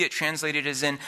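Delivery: usual speaking voice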